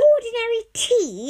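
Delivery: high, loud disdainful sniff of a question; snooty Mister Uppity. Retold or imitated. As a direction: look down your nose at plain tea. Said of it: "he was like this".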